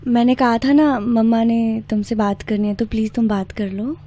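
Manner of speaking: ordinary talk, nothing marked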